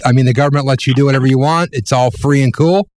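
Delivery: ordinary delivery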